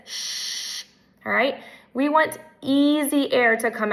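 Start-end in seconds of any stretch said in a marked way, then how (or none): 0.1-0.8 a forced, airy shh with too much air; pushed hiss demo. Shown as a demonstration. Speech teacher demonstrating an S pushed too hard, forced hiss. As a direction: push the air hard through the teeth. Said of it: "and so it might sound like this".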